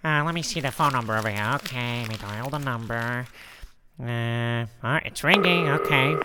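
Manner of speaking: low character voice, thinking aloud; Harvey talking to himself. Acted. Harvey dialling the number, low self-talk.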